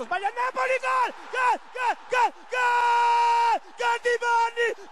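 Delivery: ecstatic shouted goal call; goal celebration shout. Real, not acted. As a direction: scream the goal over and over. Goal call by fan commentator. (urlo da gol)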